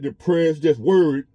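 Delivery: ordinary talk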